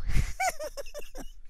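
shrill canned-style cackle after the punchline; high cackle. Real, not acted. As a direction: burst into a high cackling laugh. Host laughs at own joke.

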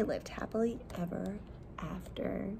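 soft hushed storybook close; a soft whisper to end the tale. Shown as a demonstration. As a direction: whisper the happy ending. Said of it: "fairy tale ending whispered".

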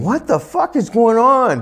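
high-pitched, incredulous exclamation; retold bafflement. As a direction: act out being totally baffled, voice shooting up. Retold shock at blurry window.

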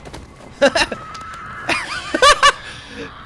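high, loud laugh; long high-pitched cackling burst; burst of laughter. Real, not acted. Player amused by the game's calm down move, bursting into laughter.

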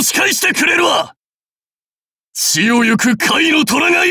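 high, forceful warlord's cry; defiant battle shout. Acted. Warlord defies the enemy. (敵に挑む武将の怒号)

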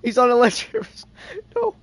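high, loud laughing yell; laughing shout. Real, not acted. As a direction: yell it while cracking up.

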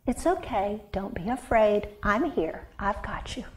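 soft, hushed comfort; calm a frightened animal gently; soothing voice. Acted. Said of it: "in a soothing voice".

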